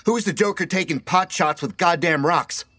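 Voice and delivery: high-pitched angry demand; shouting. A narrator's reading. Emilio to whoever throws rocks, indignant shout. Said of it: "he shouted".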